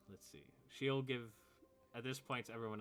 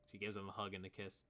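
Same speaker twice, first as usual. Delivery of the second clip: ordinary delivery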